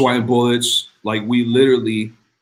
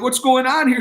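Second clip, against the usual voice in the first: surprised; 11 semitones higher than usual. Retold or imitated. Surprised at second payment.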